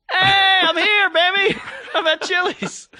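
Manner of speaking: high, loud, laughing hammed-up arrival; mock excited Chilean. Friend imagining a Chilean at Chili's, high and laughing. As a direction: burst in like a giddy tourist who found his namesake. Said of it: "if you were Chilean".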